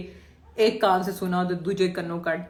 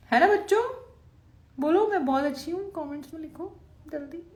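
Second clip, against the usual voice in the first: playful coaxing; 8 semitones higher than usual. Acted.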